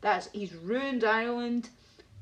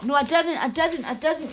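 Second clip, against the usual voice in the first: excited denial; 8 semitones higher, 8 dB louder than usual. Real, not acted. Searching for the lost pictures.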